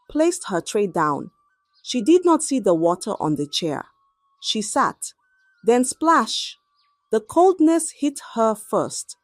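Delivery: ordinary delivery, nothing marked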